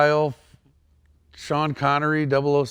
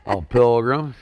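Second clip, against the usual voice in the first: John Wayne impression; 6 dB louder, slower than usual. Shown as a demonstration. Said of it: "do some John Wayne".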